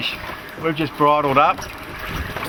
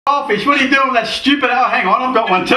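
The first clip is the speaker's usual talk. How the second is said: higher and louder, caught off guard mid-rant; excited surprise; 8 semitones higher, 4 dB louder than usual. Real, not acted. Fisherman hooking a fish while scolding another, excited surprise. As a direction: blurt it as the rod suddenly bends.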